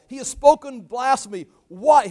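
loud, high show of indignation; playing outraged. High priest playing out the role, feigned outrage. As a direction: proclaim it with theatrical outrage. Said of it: "he's just playing out the role".

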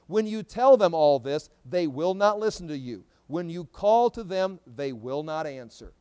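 slow, loud prophetic proclamation; forceful scripture reading. Acted. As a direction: deliver the verse slowly and emphatically. Reads scripture aloud to congregation.

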